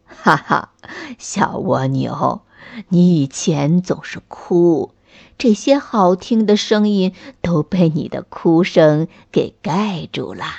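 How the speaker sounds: grandfather teasing gently with a smile; smiling voice. Acted. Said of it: "大树公公笑着说".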